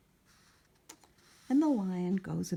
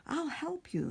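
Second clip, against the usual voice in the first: high, small mouse voice; 9 semitones higher than usual. Acted. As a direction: offer help in a tiny mouse voice. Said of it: "the mouse is saying".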